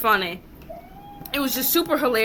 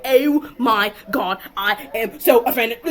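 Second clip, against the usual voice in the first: mocking offended; 5 dB louder, slower than usual. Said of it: "and they were like".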